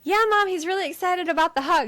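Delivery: high, quick, cheery phone voice; chirpy placating. Retold or imitated. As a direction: imitate a daughter humoring her mom. Imitates Kate placating mom on phone.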